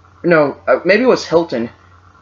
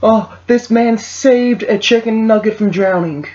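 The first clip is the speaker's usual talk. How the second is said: mock news voice; 8 semitones higher, slower than usual. Mocking feel-good Facebook news.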